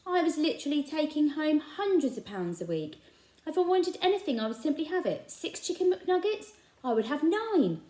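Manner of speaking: high, proud bragging voice; boastful shopkeeper. A narrator's reading. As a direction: puff up about riches. Raj boasting about riches.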